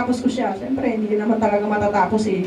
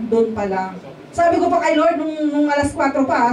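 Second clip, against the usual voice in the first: usual speaking voice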